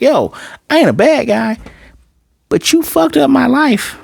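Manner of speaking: high-pitched, worked-up complaint; aggrieved reproach. Retold or imitated. Zeke blaming someone for ruining his life, aggrieved. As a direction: push it up high and lay the blame. Acted reproach quoted from a character.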